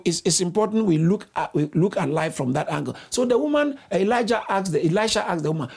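ordinary talk, nothing marked

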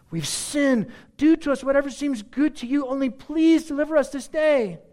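high pleading Israelites; the people begging God for deliverance; desperate plea. Acted. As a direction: beg for rescue, voice lifted high.

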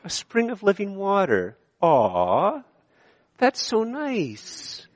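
high, slow, sugary cooing; mock-sweet aww voice; mocking sentimental Canadian. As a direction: coo it like a sappy greeting card. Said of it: "as Canadians, we'd go".

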